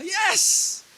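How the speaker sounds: high, drawn-out celebratory shout; acted shout. Imagined marcher at Jericho shouts after circling, acted shout. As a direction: shout it out in triumph. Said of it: "Tas sisigaw".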